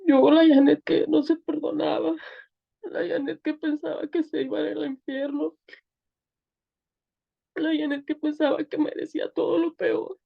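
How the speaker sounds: real tears as she tells it; choked-up weeping testimony; crying. Real, not acted. Tearful testimony of being forgiven.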